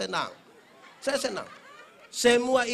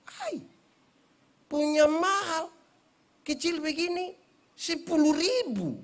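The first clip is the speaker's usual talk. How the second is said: higher, slower, incredulous complaint; mock shocked haggling; 8 semitones higher, slower than usual. Preacher bargaining with an old coconut seller, shocked at the price. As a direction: balk at the price in mock disbelief.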